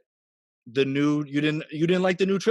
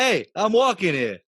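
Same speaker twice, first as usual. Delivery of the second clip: brash New York accent, pitched up; 4 semitones higher, 4 dB louder than usual. Shown as a demonstration. Podcast host shows co-hosts a New York accent, brash New York voice. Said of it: "do a better New York accent".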